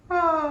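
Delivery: high head voice tone; creaky vocal fry warmup. Shown as a demonstration. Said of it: "Try it".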